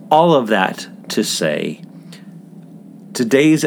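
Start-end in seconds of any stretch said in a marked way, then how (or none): 0.1-1.8 "All of that to say" with a light Southern drawl on "All"; Southern accent. Shown as a demonstration. Host slipping back into his Southern roots, light Southern accent. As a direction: slip into a mild Southern accent. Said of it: "I had come by an accent legitimately".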